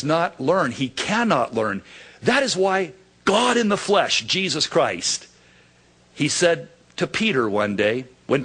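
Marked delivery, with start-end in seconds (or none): none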